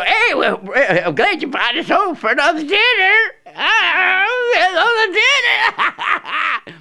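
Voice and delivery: very high hillbilly voice breaking into laughter, slowing at the end; gleeful guest cracking up mid-line; laughing hillbilly guest. Retold or imitated. Hillbillies arriving for dinner again.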